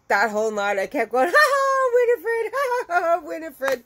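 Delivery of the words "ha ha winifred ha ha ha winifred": high, loud acted cackling; mock teasing laughter. Wife teasing husband all night, exaggerated laughing. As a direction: hoot the name through put-on laughs. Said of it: "I'd laugh and laugh".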